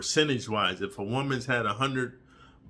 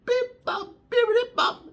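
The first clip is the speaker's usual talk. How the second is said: very high squeaky beep bop; silly beep noise; 3 dB louder than usual. Retold or imitated. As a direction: chirp it like a toy. Mimicking a tiny sound.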